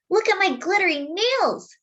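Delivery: put-on high, bright little girl showing off her skirt; excited girl voice. Sister shows off her skirt to brother, high and proud.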